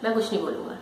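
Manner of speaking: a soft, settled reply; hushed saint's answer; quiet calm saint. Retold or imitated. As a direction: say it gently and low, at peace. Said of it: "उसके पास आके बोलते हैं".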